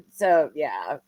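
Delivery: usual speaking voice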